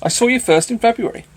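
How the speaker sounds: loud playful announcement; swelling declaration. Acted. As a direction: proclaim it for all to hear. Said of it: "Let my accent swell to Mickleham".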